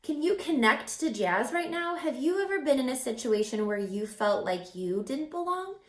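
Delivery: ordinary delivery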